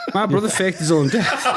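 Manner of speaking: cracking up mid-sentence; laughing it out. Real, not acted. Laughing while correcting a friend's mix-up.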